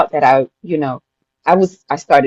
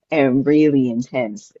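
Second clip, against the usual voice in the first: normal talking voice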